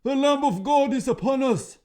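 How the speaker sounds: very high, loud terrified shout; high frantic troll shriek; panicked troll. A narrator's reading. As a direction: scream the doom as you flee.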